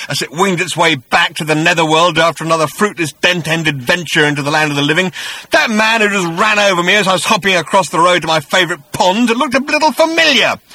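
loud, high bitter roar. A narrator's reading. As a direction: rage at the killer. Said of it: "The echoes of his voice roared".

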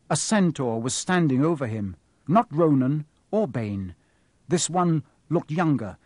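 ordinary talk, nothing marked

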